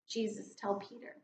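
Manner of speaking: ordinary talk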